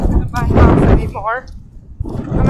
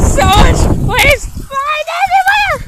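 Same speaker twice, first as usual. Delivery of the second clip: excited shout; 11 semitones higher, 4 dB louder than usual. Real, not acted. Shouting at flying things.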